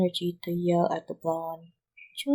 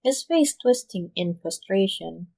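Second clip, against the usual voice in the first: ordinary delivery, nothing marked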